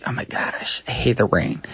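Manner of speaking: soft breathy whisper. Real, not acted. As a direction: whisper it in disgust. Complaining about rain, whispered.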